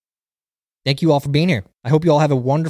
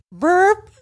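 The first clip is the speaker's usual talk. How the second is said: mock fart noise; 4 dB louder than usual. I just hear.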